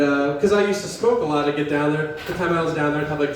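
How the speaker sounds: ordinary talk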